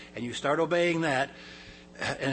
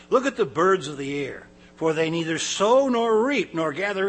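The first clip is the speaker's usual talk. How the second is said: scripture reading; 2 semitones higher, 5 dB louder than usual. Acted. Preacher reading Matthew aloud to the congregation, proclaiming. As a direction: proclaim the verses like from a pulpit.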